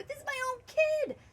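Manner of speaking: high, outraged protest; dad objecting loudly; indignant father. A narrator's reading.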